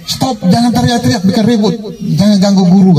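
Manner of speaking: high-pitched mock telling-off; retold scolding. Disciples forbidding the beggar to bother the teacher, scolding. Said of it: "Murid-muridnya larang dia".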